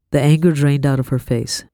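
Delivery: ordinary delivery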